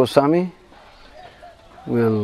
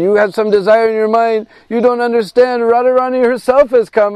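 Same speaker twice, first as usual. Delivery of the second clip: much higher and louder, overwhelmed with wonder; ecstatic realization; 8 semitones higher, 3 dB louder than usual. Acted. Sanatana tells his brother Radharani came, ecstatic awe.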